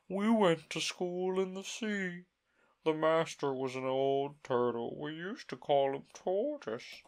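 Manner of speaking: slow, high, mournful turtle voice; a weepy old turtle settling in to tell his tale; mock turtle voice. The Mock Turtle to Alice, slow and high.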